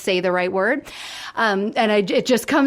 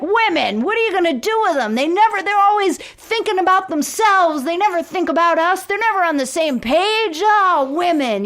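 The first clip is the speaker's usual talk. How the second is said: higher-pitched mock lament about women; commiserating; 7 semitones higher than usual. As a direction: commiserate in an exaggerated, put-on voice. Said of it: "he's commiserating".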